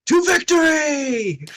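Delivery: high, drawn-out battle cry; loud, long, triumphant call; rallying cry. Acted. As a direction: shout it out to rouse an army. Rallying the goblins, hands out.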